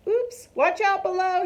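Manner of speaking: high, loud call down below; yelling warning. A narrator's reading. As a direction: warn the people underneath. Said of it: "he yelled".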